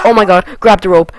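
urgent yell at the screen; panicked shout. Real, not acted. Panicked yelling at game.

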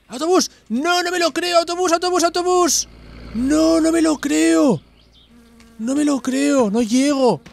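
can't believe the bus is there; excited disbelief. Real, not acted. Player spotting the bus, frantic excited yelling.

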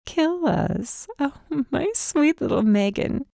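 mocking. Raven mocking Megan's threat, high scornful lilt. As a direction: toy with her, lilting high and scornful. Villain mocks her beaten captive.

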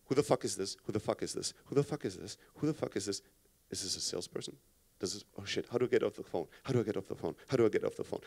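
low, soft, wary thinking to himself; acted inner voice, very low and under the breath; hushed inner monologue. Man thinking to himself as an unknown caller rings, hushed. Voicing his thoughts on unknown call.

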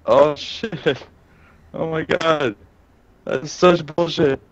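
frustrated. Real, not acted. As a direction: cry out at the unfair loss. Gamer loses a fight, frustrated.

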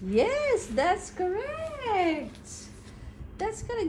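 high, stretched-out sing-song praise. Real, not acted. Mother praising baby's answer, sing-song baby talk.

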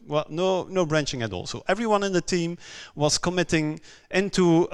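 usual speaking voice

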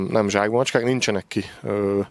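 normal talking voice

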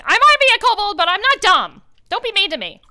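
high, loud squeaky protest; indignant kobold. Acted. Kobold insulted by a lowball offer, high and squeaky. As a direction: squeak back offended like a small kobold. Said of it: "he looks at you like".

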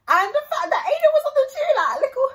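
very high, loud burst of hype; excited squeal. Real, not acted. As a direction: squeal it out, thrilled. Excited rave about Aiden's part.

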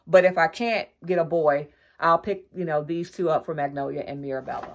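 ordinary delivery

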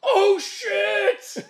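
high, loud startled yelp through a laugh; laughing mock-shock as the villains panic. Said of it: "they're all like".

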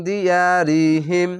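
দিয়ারিহিম slow, drawn-out; Quranic recitation. Shown as a demonstration. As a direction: recite দিয়ারিহিম slowly as one word. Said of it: "এরকমভাবে পড়বেন".

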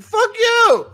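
very high, loud yell; mock kid's explosive shout; defiant outburst. Student blowing up at his teacher, defiant high shout.

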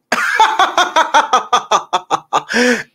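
long, high staccato cackle; exaggerated oblivious giggling; mock creep laugh. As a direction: keep the fake laugh running.

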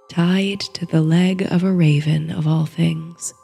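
ordinary delivery, nothing marked

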